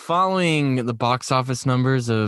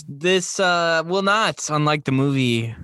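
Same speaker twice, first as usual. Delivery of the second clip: ordinary talk, nothing marked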